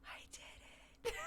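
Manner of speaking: soft unvoiced gasp then hushed I did it; toddler's amazed whisper. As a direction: breathe it out in awe.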